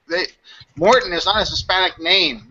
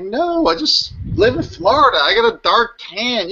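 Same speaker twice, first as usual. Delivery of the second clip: higher, exasperated protest; indignant retort; 6 semitones higher than usual. Retold or imitated. To people calling him Italian, a higher exasperated retort. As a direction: set them straight, fed up.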